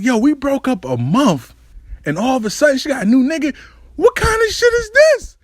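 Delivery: high-pitched indignant venting; retold mad outburst. Storyteller replaying his reaction to the new boyfriend, mad. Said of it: "I'm mad".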